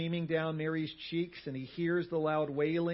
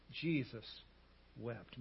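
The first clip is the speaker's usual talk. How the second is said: solemn, hushed; 4 semitones lower, 7 dB quieter, slower than usual. Real, not acted. Solemn return to the verse.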